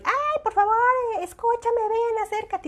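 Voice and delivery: high, loud call to a distant mom; calling out across. Retold or imitated. A mom calling loudly across the room to another. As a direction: shout across the room so she hears. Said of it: "cuando yo estoy gritando de un extremo".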